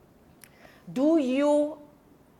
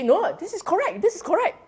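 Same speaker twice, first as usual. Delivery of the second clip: mock insistent student; 10 semitones higher, faster than usual. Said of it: "Or you say".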